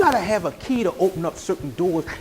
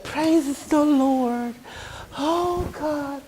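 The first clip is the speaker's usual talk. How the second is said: slow, soft, breathy-free pious murmur; slow hushed holy-talk impression; weak-sounding preacher; 5 semitones higher, 5 dB quieter, much slower than usual. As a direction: mimic a limp, overly gentle pastor. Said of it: "sound kinda weak".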